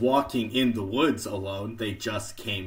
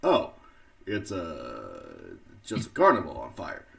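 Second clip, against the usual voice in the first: a hint of Obama; Obama impression. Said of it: "I'll do Obama".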